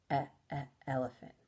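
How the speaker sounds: soft, quick "E, e, elephant" stressing the first sound. Shown as a demonstration. Teacher sounding out the start of a word for a kindergarten class. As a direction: break "elephant" into its opening E sound, then the word. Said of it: "What does elephant start with?".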